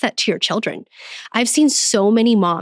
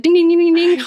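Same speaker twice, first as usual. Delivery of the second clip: higher, louder sing-song ding ding; mock alarm bell; 9 semitones higher, 5 dB louder than usual. Mom describing how she spots a trigger, playful alarm-bell dings. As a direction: ring it out like a warning bell.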